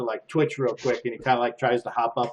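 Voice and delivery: usual speaking voice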